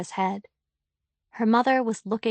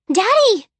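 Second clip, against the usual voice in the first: high, loud joyful cry of Daddy; little girl greeting her father, thrilled; excited child call; 12 semitones higher, 9 dB louder than usual. A narrator's reading.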